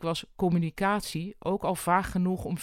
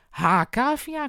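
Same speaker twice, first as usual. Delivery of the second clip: cheerful greeting; 8 semitones higher, 6 dB louder, slower than usual. Acted. Boss bursts in greeting.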